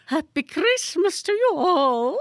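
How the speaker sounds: high, gracious society lady voice; gracious grand lady. A narrator's reading. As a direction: beam it out like a gracious dame. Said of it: "she said graciously".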